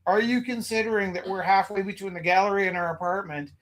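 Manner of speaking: usual speaking voice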